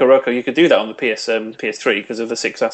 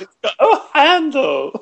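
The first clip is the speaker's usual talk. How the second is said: high, slow mock squeal; mock Miyamoto; imitating Miyamoto; 13 semitones higher, slower than usual. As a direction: repeat it in a squeaky voice. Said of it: "hold the GameCube up and go".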